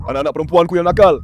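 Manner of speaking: normal talking voice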